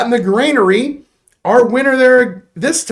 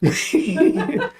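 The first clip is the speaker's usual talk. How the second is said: high real laughter swallowing the word; cracking up; 9 semitones higher, 4 dB quieter than usual. Real, not acted. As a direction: burst out laughing as you start to speak. Cracks up at own silly performance.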